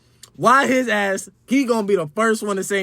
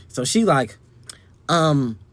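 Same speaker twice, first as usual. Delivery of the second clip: normal talking voice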